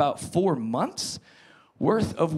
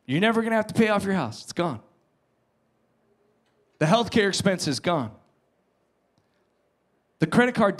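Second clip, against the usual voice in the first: cheerful bank caller; 4 semitones higher than usual. Pretend. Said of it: "you get a phone call from the bank and they say".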